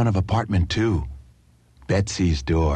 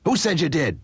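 snapped; 8 semitones higher than usual. A narrator's reading. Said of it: "snapped the man".

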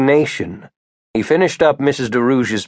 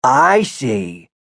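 put-on drawn-out, doubtful old man; disbelieving; 2 semitones higher than usual. A narrator's reading. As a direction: acknowledge it without believing a word. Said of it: "not believing him".

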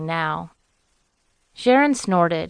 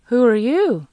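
sudden loud, high question; startled; 5 semitones higher, 5 dB louder than usual. A narrator's reading. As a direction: call out in alarm, higher. Eli startled by stranger.

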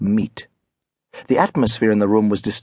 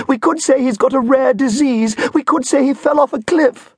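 very high, shrill-pitched melodramatic wail; over-the-top wail of a snobbish mother; hammed-up wailing mother; 13 semitones higher than usual. A narrator's reading. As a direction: wail it out like a mother fearing scandal. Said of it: "Missus Elliot wailed".

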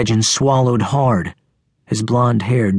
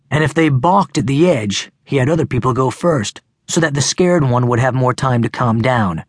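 plain reading voice

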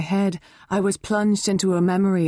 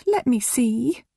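begging; 7 semitones higher, slower than usual. Acted. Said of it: "Mum begged".

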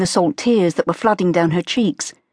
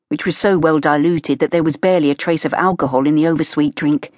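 ordinary delivery, nothing marked

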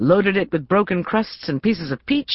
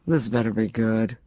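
a touch lower, husky, just woken; husky and groggy; 5 semitones lower than usual. Acted. Woken friend answering the phone at dawn, husky. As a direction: growl it out half-asleep. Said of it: "her voice huskier than usual".

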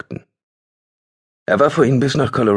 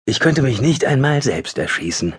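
bitter, higher inner voice; 7 semitones higher than usual. Acted. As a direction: spit it out with bitter self-mockery. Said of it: "dachte er bitter".

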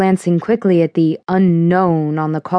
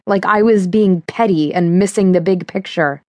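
usual speaking voice

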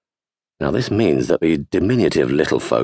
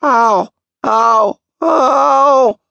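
high, louder ow cries; loud mock whimpers of pain; hammed-up pain; 6 dB louder, much slower than usual. A narrator's reading. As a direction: overplay the discomfort for sympathy. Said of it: "half under his breath".

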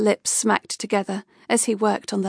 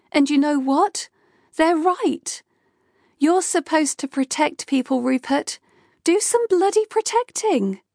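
higher, heated scolding that climbs; Kay berating her boss for never being there; angry rant; 5 semitones higher than usual. Acted. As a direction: lay into him, building to the last line.

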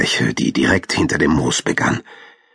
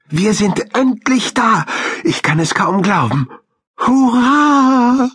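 high-pitched little hamster cheering; high and jubilant, ending on a loud cheer; elated calling out; 3 dB louder than usual. A narrator's reading. Hamster cheers at reaching the valley, high jubilant voice. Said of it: "rief Krümel".

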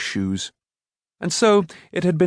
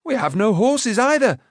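high, quick-witted protest; objecting; 11 semitones higher than usual. A narrator's reading. Boy turning the smith's argument back, objecting. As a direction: argue back, pitch well up. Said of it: "objected Taran".

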